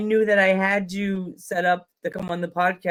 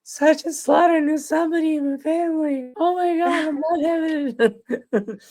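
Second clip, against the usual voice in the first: much higher voice, breaking into laughing sobs; freaking out; 10 semitones higher than usual. Retold or imitated. Host reliving his overexcited reaction to Sergeant Slaughter, high laughing sob. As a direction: squeal in disbelief, losing it. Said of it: "That's me freaking out".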